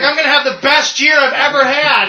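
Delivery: high-pitched giddy gloating; Lex Luthor. Retold or imitated. Lex Luthor gloating at Superman's funeral, giddy. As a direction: gloat through a laugh. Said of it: "He's like".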